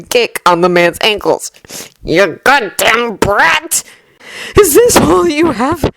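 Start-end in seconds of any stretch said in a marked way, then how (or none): 2.0-3.8 slow, seething insult, pitched up; villain's drawn-out, rising curse; angry curse. A narrator's reading. Villain curses the boy mid-fight. 4.6-5.9 high-pitched defiant taunt; high, straining fighter's jeer; breathless challenge. A narrator's reading. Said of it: "Midoriya growls, breathless".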